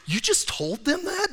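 high, incredulous question; can't believe what he just said; surprised wife. Retold or imitated. Wife shocked he told them that, incredulous. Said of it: "And Gaynell said".

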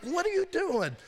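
high, incredulous outburst; exasperated scolding. Acted. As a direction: scold him in high-pitched disbelief. Acted scolding of Samson.

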